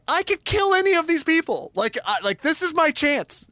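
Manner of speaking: high, keyed-up furious thoughts; seething inner rage. Retold or imitated. Thinking about the noisy neighbors at night, seething. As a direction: boil over inside, imitating his own rage.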